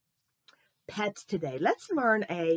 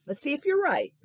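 ordinary delivery

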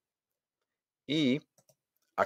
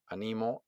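"animo" with the weight on NI; stress on middle syllable; 8 dB quieter than usual. Shown as a demonstration. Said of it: "Entendamos la diferencia entre". ("animo" acentuado en NI)

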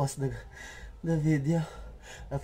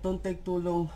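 usual speaking voice

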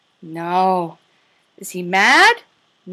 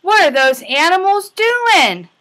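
gasping, raised wonder; mock surprise; 6 semitones higher than usual. Teacher asking toddlers with a playful gasp. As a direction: gasp and ask like it's a big surprise.

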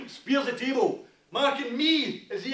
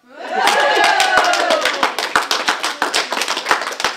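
wheezing laugh; 11 dB louder than usual. Real, not acted. Laugh heard after the poem.

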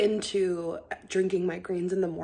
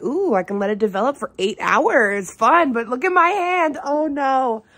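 excited; 6 semitones higher, 8 dB louder than usual. Retold or imitated. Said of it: "I was like".

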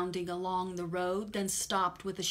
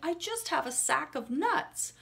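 higher nervous squirrel voice; little squirrel voice; 8 semitones higher, 4 dB louder than usual. Acted. The squirrel answering the rat, high and nervous. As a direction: answer like a small shivering squirrel. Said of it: "the squirrel replied".

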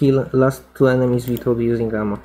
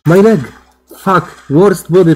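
sudden yell of pain and frustration; pained shout; 4 semitones higher, 7 dB louder than usual. Real, not acted. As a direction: cry out as the leg gets hit. Player shot in the leg.

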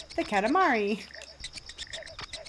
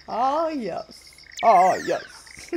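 a hint of a plummy, grand voice, second one louder; Matt Berry; 6 dB louder, much slower than usual. Imitating how she reads the King, lightly plummy. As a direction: toss off a lordly "ah, yes". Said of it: "Kind of like Matt Berry".

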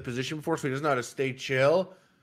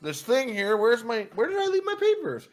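feigned searching; 7 semitones higher than usual. Retold or imitated. Playing dumb to avoid confrontation.

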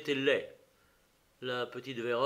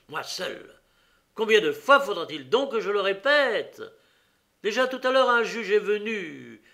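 much louder and higher, furious; enraged; 8 semitones higher, 9 dB louder than usual. Acted. Prisoner cuts off the detective, enraged shout. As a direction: spit it out in a rage. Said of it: "un véritable accès de rage".

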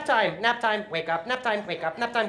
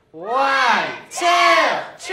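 louder count; 4 semitones higher, 9 dB louder, much slower than usual. Shown as a demonstration. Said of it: "Must count louder".

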